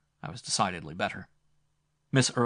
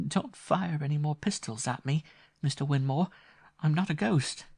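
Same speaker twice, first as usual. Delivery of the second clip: put-on higher, lightly teasing girl's voice; playful young woman; 7 semitones higher, 3 dB louder than usual. A narrator's reading. Grace teasing the frightened Winmore on the stairs, playful high voice. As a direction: tease him gently, she's quite safe.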